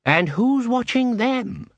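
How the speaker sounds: high, slow, pointed question; suspicious guild master. Acted. Plays Cruces questioning his guard.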